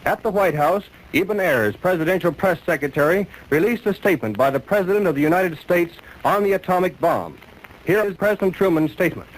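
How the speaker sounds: high, loud vintage radio announcer; 1940s radio news bulletin. Real, not acted.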